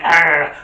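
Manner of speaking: loud short "Arr!" burst; explosive mock-attack growl; sudden growling roar. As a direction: pounce with a loud dog snarl. Terrier acts out his attack.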